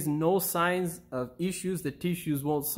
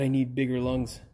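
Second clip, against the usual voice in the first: usual speaking voice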